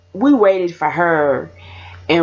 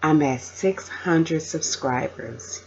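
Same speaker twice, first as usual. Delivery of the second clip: ordinary talk, nothing marked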